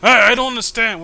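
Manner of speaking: usual speaking voice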